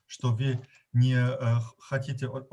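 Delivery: ordinary talk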